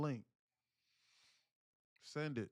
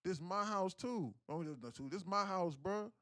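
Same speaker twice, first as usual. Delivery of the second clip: mock indignant challenge, higher pitched; street-style confrontational comeback; retold confrontation; 7 semitones higher than usual. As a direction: square up over whose house it is. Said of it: "my brother was like".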